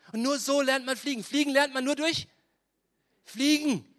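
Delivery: preaching emphatically. Acted. Preacher driving home point.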